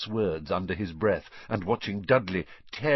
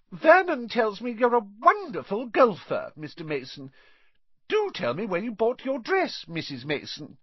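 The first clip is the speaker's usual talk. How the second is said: much higher, louder gushing aunt; Aunt Petunia voice; 13 semitones higher, 5 dB louder than usual. A narrator's reading. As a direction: flatter the guests sweetly. Aunt Petunia rehearsing compliments.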